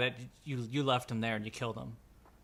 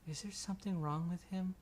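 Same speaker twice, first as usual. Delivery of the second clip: put-on children; 6 semitones higher, 3 dB quieter than usual. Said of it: "They just go".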